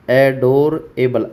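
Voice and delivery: एडोरेबल slowed down before being split into एडोर and एबल; teacher breaking एडोरेबल apart; slow syllable demo. Shown as a demonstration. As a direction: stretch एडोरेबल out slowly. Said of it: "एडोर मत, एबल".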